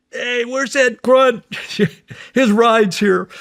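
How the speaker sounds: high, slow call across the base; retold yell. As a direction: yell out for the grunt, drawn out. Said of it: "I hear this scream".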